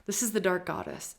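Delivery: ordinary delivery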